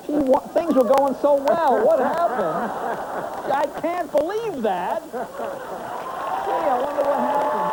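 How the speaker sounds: high-pitched, put-on bewilderment; mock surprise. Host reacting to a congressman retiring, high mock surprise.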